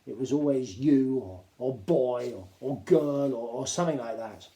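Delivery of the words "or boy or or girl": ordinary delivery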